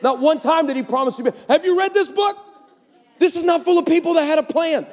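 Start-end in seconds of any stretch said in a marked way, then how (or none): 1.5-4.9 preaching shout. Real, not acted. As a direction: preach it hard. Preacher's climax.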